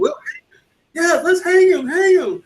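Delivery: high, loud squeaky mob call; hammed-up lynch mob. Mock movie mob.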